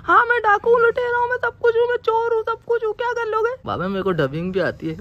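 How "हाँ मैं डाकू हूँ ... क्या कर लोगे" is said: high falsetto wife scolding; mimicking wife. Husband mimics his wife accusing him, high falsetto. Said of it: "mimicry कर रहा हूँ मैं".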